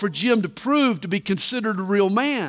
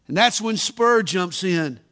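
ordinary talk, nothing marked

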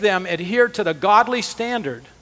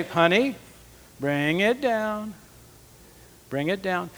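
softer and slower, calming voice; calm, soothing; 5 dB quieter, slower than usual. Shown as a demonstration. Husband calming his screaming wife, demonstrated softly and slowly. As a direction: talk her down gently, like turning down a thermostat. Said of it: "We're gonna do this calmly".

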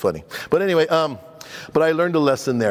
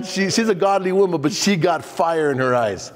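normal talking voice

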